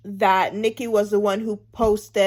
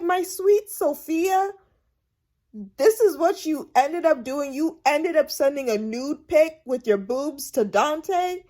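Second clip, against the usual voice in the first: disappointed auntie, pitched up; mock-tender scolding; 5 semitones higher than usual. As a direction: chide her sweetly, then deliver the blow. Scolding Sophia, voiced reaction.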